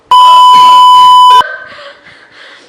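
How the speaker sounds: a shrieking, high burst of laughter; piercing incredulous cackle; loud laugh. Real, not acted. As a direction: explode into disbelieving laughter. Mocking his claim.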